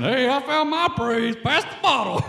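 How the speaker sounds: mock tipsy partier. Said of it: "Some of you might be saying".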